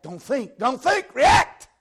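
rising from firm to a high scream; building to a shout. Real, not acted. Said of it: "You gotta react".

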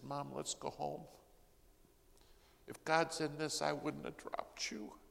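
high, tearful plea to mom; retold crying. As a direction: plead as if through tears. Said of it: "I'm crying. She's crying".

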